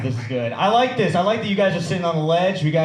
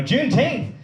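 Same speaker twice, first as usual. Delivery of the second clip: higher, mock-puzzled cousin; baffled question; 8 semitones higher than usual. Cousins on the phone hear of Juneteenth, mock bafflement. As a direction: imitate someone who never heard the word.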